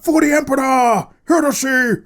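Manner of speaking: shouted battle cry of a space marine; gruff space marine. Pretend. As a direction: bellow it like a zealous soldier. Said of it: "says the Space Marine".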